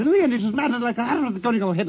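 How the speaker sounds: high, racing gabble like a record sped up; imitation of a gramophone winding up into manic nonsense; madder and madder. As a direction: speed up into a frantic high-pitched babble. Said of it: "he became madder and madder".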